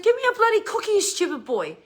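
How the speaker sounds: loud, high scolding mum; high-pitched telling-off at her son; mother voice. Retold or imitated. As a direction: raise the voice into a stern mother's demand. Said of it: "Out come the mother voice".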